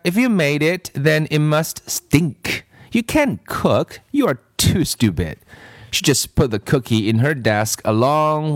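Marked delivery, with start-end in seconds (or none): 0.0-5.4 put-on mean girl. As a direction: sneer at her classmate's cooking. Kathy voiced high.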